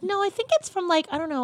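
high-pitched imitation of a puzzled stranger; unsure stranger. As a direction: mimic someone trying to place a face. Mimics unsure stranger who half-recognizes her.

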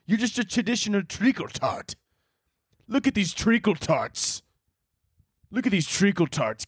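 high-pitched, hammed-up British accent; mock British taunt. Someone about to get in a fight, mock British taunting. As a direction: jeer in a cartoon British accent. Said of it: "about to get in a fight and they're like".

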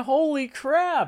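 high, drawn-out awe; amazed exclamation. Real, not acted. Fan blown away by the Force feat, amazed exclamation. Amazed outburst about the scene.

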